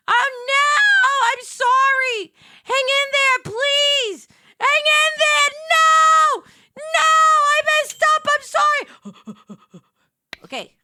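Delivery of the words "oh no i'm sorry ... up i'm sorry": high, loud frantic apology; shouting sorry in a panic; panicked pleading. Real, not acted. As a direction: beg the customer to hang on. Begging angry game customer.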